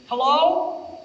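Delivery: put-on high, far-off creaky old man answering; old guy voice. Old voice actor answering the director's call, creaky old man. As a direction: play a frail old man picking up. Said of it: "when the phone rang".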